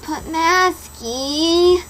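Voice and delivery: high, drawn-out whine; whiny moan.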